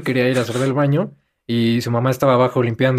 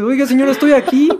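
mock indignant protest; 10 semitones higher, 5 dB louder than usual. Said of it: "fue como de".

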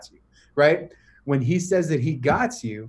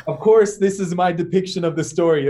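ordinary delivery

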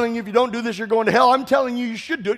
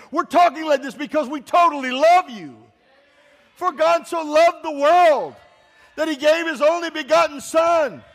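raised, ringing pulpit voice; fervent preaching; 4 semitones higher than usual. Acted. Pastor preaching to unbelievers with rising fervor. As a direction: preach it out with fire to the crowd.

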